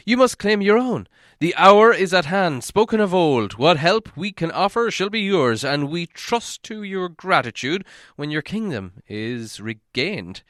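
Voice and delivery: high, loud ceremonial pledge; lofty speech of the town master; grand proclamation. A narrator's reading. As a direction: proclaim it to the crowd. Master pledges help to Thorin.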